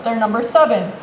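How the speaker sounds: ordinary delivery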